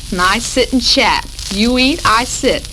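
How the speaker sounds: ordinary talk, nothing marked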